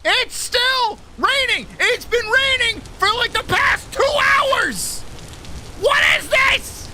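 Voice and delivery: high, slow shouted complaint about the rain; frustrated shout. Real, not acted. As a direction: yell at the sky, fed up and losing it. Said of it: "Losing my fucking mind over here".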